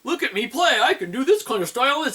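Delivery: high, put-on bragging voice; a lightly hammed-up boastful player; mock show-off guitarist. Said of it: "Steve Terraberry on YouTube, who does those".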